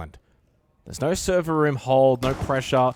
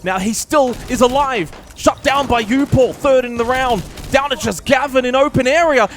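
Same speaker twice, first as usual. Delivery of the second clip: excited play-by-play; 9 semitones higher, 9 dB louder than usual. Real, not acted. Live play-by-play of the round.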